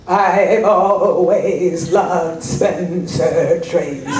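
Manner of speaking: drawn-out, grandly slow line reading; milk every word like a big movie moment; slow dramatic declaration. Retold or imitated. Actor on set to a silent crew, slow and grand.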